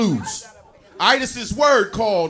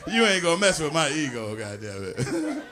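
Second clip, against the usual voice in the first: ordinary delivery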